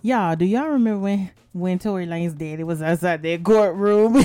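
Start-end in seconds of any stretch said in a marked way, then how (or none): none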